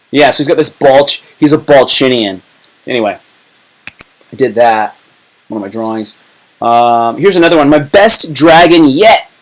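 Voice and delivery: ordinary talk, nothing marked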